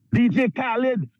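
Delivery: ordinary talk, nothing marked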